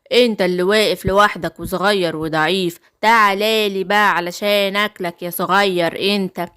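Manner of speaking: low-pitched wolf taunt; low, menacing storybook wolf; taunting wicked wolf. Acted. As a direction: play the wolf threatening a small cub, low.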